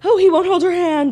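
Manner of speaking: high, loud imitation of gossip; mock dismay. Host imitates fans fretting over hand holding, mock dismay. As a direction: whine it up high, mocking the fuss.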